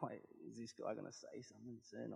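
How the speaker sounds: puzzled inner voice. Retold or imitated. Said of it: "looking at the fire like".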